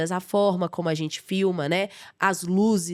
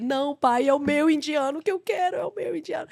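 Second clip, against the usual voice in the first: whiny plea; 7 semitones higher than usual. Retold or imitated. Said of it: "Aí eu falei".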